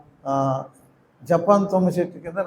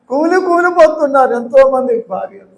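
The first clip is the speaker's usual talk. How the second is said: preaching at full force; 7 semitones higher, 9 dB louder than usual. Acted.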